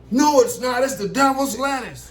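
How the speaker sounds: high, mock-indignant cop. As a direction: voice the cop insisting it's weed. Cop arguing about the blunt.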